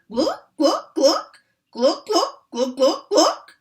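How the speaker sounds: high, loud clucks; hen clucking. A narrator's reading. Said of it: "the hen went".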